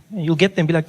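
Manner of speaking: ordinary talk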